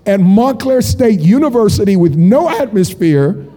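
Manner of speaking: normal talking voice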